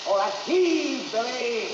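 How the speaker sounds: high, loud, drawn-out heave; called cue. Real, not acted. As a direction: urge on the effort with a long shout. Director calls a physical cue.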